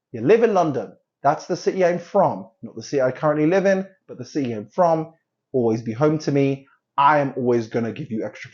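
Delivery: usual speaking voice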